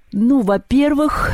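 high, slow yawned words; yawning. A narrator's reading. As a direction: stretch the words into a yawn. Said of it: "зевнул Перун".

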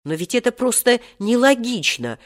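mildly acted heated protest; heated objection. A narrator's reading. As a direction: object with feeling, voice lifted. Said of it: "горячо возразил Серёжа".

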